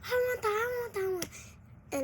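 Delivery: high, slow whining plea; mock a crying little kid; little brother in the pool whining, high and slow; whiny little brother. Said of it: "He was like".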